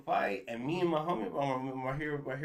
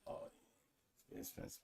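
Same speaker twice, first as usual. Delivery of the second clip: hushed whisper, barely voiced; leaning in to whisper; 20 dB quieter than usual. Real, not acted. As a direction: drop to a secret whisper. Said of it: "keep that shit low over there".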